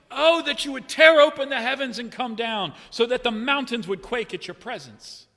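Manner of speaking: loud anguished plea to heaven; prophet's loud cry to heaven; cry of lament. Acted. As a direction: cry it out to God. Prophet crying out to God.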